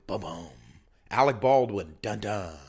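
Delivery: ordinary delivery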